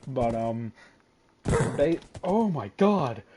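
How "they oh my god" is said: loud, high startled gasp of words; startled shout. Real, not acted. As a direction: jump at a sudden fright. Sudden scare in game.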